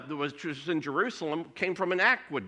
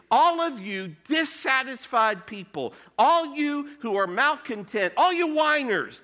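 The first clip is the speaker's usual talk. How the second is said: high, louder calling out to the dissatisfied; raised, carrying call to all the whiners; voicing Jesus calling out; 7 semitones higher, 4 dB louder than usual. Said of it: "Jesus is saying".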